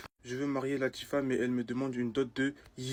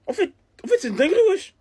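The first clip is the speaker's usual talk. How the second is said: very high, loud cracked-up outrage; outraged squeal; 10 dB louder, faster than usual. Acted. Ranter losing it over the emails, outraged squeal. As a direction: screech it in disbelief.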